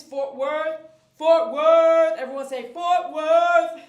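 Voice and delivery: belting it out loud and high; rally the crowd to chant along; loud sing-song hometown shout-out; hometown cheer. Real, not acted. Hyping hometown to viewers.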